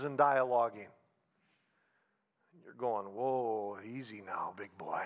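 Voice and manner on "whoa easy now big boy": a much lower, quieter mock voice; low playful put-on grumble; mock gruff objector. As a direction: growl it low like a startled skeptic. Mock reaction to his advice.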